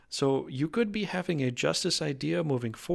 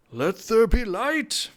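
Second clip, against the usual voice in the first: higher, louder and slow, like scripture; grand divine proclamation; 9 semitones higher, 9 dB louder, slower than usual. Acted. Reader voicing a divine card, grand slow proclamation. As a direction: intone it like God making light.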